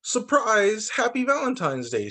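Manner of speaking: a cheerful mock shout of surprise; group shout. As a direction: call it out like friends jumping out at a party. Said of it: "shout all the dinosaurs".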